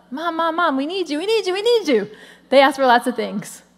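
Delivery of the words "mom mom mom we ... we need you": put-on higher, pleading kid voice calling mom; imitating whiny kids. Kids pestering mom while she cooks, higher and whiny. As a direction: nag like a small child who wants mom now.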